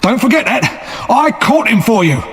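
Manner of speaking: cried out. A narrator's reading. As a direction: force the soldiers to listen. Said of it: "he cried".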